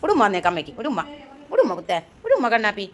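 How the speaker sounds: low, tender coaxing, a laugh at the end; coaxing for a kiss. Real, not acted.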